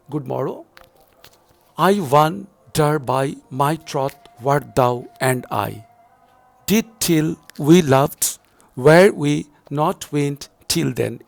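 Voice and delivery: slow, loud, every beat stressed; scanning aloud. Shown as a demonstration. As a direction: read it out in iambic beats, hammering each stress. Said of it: "read the poem, scan the poem from the beginning".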